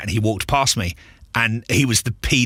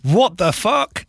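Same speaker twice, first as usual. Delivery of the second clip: shocked retold exclamation; 9 semitones higher, much slower than usual. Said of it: "I shit myself".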